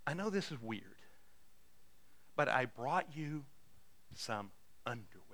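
very quiet, halting and low, trailing away; sheepish confession. Retold or imitated. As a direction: offer it awkwardly, softly, with long pauses. Awkward gift at neighbor's door.

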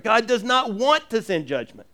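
normal talking voice

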